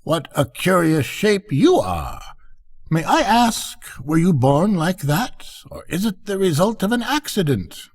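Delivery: a lightly voiced fussy duck asking; curious duck. Duck character speaks.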